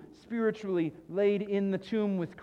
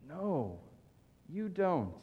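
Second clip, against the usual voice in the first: ordinary talk, nothing marked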